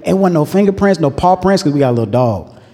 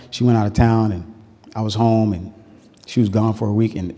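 usual speaking voice

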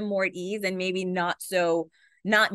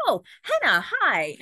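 high, louder mock greeting; imitating a surprised hello; 6 semitones higher, 5 dB louder, slower than usual. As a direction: pop the name out high and loud. Imitating celebrity greeting her.

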